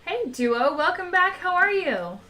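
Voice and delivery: high, bright welcome; cheerful greeting. Real, not acted. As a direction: greet a friend warmly. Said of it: "greeting a viewer live".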